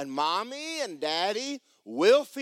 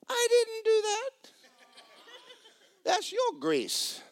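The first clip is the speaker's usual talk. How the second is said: high, slow, mock-indignant; indignant retort; slower than usual. Maintenance man to the homeowner, high and mock-indignant. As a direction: pitch it up, drawn out and pointed.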